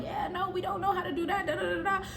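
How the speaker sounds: high, soft mocking imitation. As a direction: mimic him in a high little voice. Coworker backing him up, mocked.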